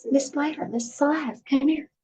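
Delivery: high hushed call; urgent high call from the doorway. Retold or imitated. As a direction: neighbor hurrying out to catch her, high call. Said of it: "She's like".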